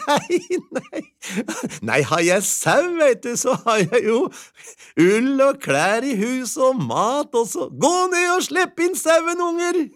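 put-on wife sending children for the sheep; gleeful wife. A narrator's reading. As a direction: build up to a happy call. Wife's happy voice.

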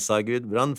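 ordinary delivery, nothing marked

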